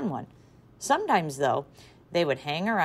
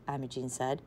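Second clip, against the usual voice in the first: plain reading voice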